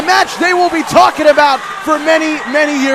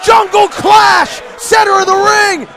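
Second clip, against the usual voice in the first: loud, high, slow big-finish call; excited shout; 6 semitones higher, 4 dB louder, slower than usual. Real, not acted. Calling the match-winning finisher.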